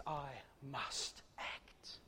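slow hushed whisper. Acted. Christ resolving to act for his people, slow whisper. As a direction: breathe it, barely voiced, very slow.

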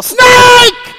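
sudden high, loud shout of alarm; yelled warning. Retold or imitated. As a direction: yell it in a panic. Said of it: "I yell".

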